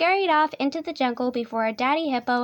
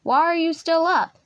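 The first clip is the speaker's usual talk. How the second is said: raised, louder scolding lion dad; growling lion; 5 semitones higher, 5 dB louder than usual. A narrator's reading. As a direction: lightly growl a loud demand. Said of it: "growled Daddy Lion".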